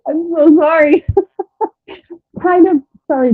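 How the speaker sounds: half-laugh a fake apology. Real, not acted.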